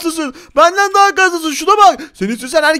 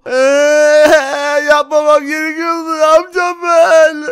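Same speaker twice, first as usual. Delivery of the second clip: drawn-out hammed-up wail; mock crying; 4 dB louder, much slower than usual. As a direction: wail in pretend despair. Vücudu bozulunca ağlıyor. (abartılı, yalandan ağlayarak)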